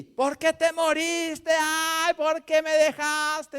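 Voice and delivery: high, slow, loud lament to the dead; mock wailing at the dead. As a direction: cry out to the dead man, pleading. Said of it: "le gritaban al muerto". (lamento agudo y lento al muerto)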